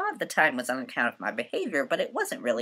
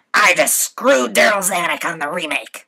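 croaky boast; 4 semitones higher, 12 dB louder than usual. Acted. Dying agent's boast.

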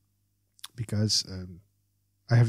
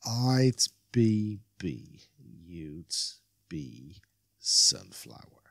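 stumbling; 8 semitones lower, much slower than usual. Acted. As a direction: fumble for each word, trailing down low.

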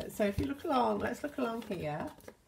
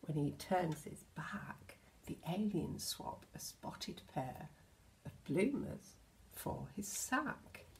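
whispered, secretive; hushed storybook whisper; 5 dB quieter than usual. Acted. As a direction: whisper so Santa won't notice. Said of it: "but shh".

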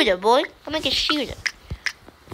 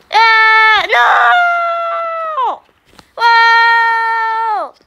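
high shrieking no; terrified scream; 5 semitones higher, 3 dB louder, much slower than usual. Real, not acted. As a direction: scream as if trapped. Trapped in game, screaming.

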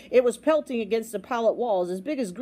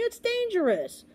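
high, slow, drawn-out plea; pleading; 12 semitones higher than usual. A narrator's reading. Boy pleads with the captain, pleading. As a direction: warn pleadingly, stretching it. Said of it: "pleaded Bartholomew".

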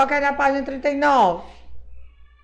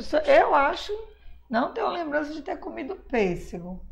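ordinary delivery

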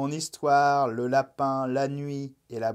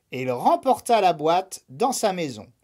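ordinary delivery, nothing marked

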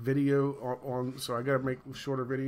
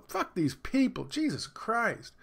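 higher, slow, fed-up mock exasperation; a lightly voiced friend, groaning in disgust; 9 semitones higher, slower than usual. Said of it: "Nebuchadnezzar says".

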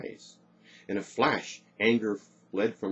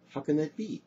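high, baffled question; puzzled, pitched up; perplexed; 7 semitones higher than usual. A narrator's reading. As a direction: ask it in mounting bewilderment. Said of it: "she thought, perplexed".

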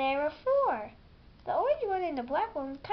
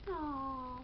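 usual speaking voice

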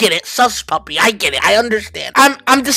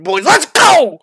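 usual speaking voice

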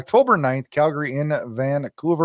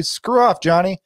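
high, brusque mock dad brush-off; a dad waving his kid away, pitched up; mock dad shooing off his son; gruff dad; 5 semitones higher, 4 dB louder than usual. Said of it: "And he's gonna say".

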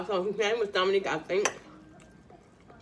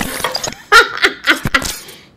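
loud breathy laugh; 5 semitones higher, 14 dB louder than usual. Real, not acted. Vlogger cracking up on camera before eating, laughing loudly. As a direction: burst of loud, airy laughter. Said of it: "I'm laughing".

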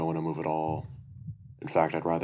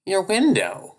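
shrieking weasel; 11 dB louder, slower than usual. Acted. Duke shrieks at the bear.

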